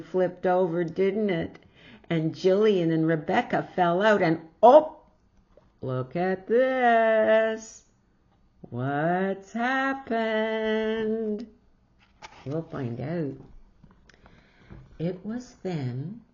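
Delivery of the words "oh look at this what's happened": high, loud, drawn-out surprise; surprised gasp. Real, not acted. Reader reacts to toboggan crash.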